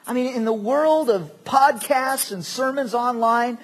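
ordinary delivery

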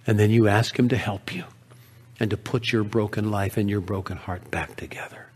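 low, soft, tender counsel; loving. Acted. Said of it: "with the most love in her voice".

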